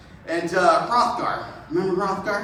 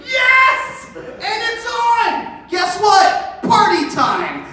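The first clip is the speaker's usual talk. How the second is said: much higher, loud whoop; wild cheering; 11 semitones higher, 5 dB louder, much slower than usual. Acted. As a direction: celebrate like winning the title. Warriors cheer, start the party.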